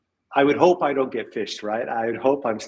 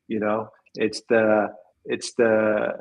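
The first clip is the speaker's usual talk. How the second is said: ordinary talk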